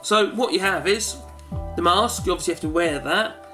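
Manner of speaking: ordinary talk, nothing marked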